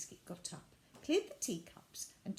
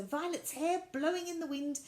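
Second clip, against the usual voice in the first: plain reading voice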